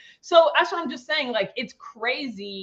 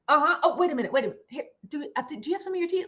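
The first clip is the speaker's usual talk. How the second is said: gentle inquisitive question; 3 semitones higher, much faster than usual. Shown as a demonstration. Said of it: "just be like".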